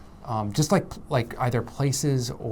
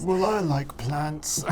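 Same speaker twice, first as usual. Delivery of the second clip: slow, higher-pitched mock-royal voice; Charles impression; 5 semitones higher, slower than usual. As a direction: impersonate an out-of-touch royal grandpa, drawn out. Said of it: "a fantastic impression of Charles's voice".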